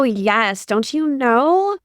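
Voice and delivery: ordinary talk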